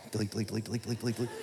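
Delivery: quick, soft, low repetition; small deflated patter after an outburst; muttered deleting. Acted. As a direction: mutter it rapidly under your breath. Erasing text after the outburst.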